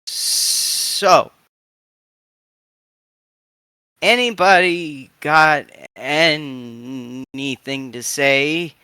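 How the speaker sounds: put-on slow, halting old voice with stammers; slow stuttering old woman. Oma Mankfeld introducing herself to the crew, slow and stuttering. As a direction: stammer slowly like a frail but sharp old woman.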